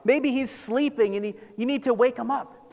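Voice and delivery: high, teasing mock advice; sarcastic taunt. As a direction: offer it as fake helpful advice. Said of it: "He's being sarcastic here".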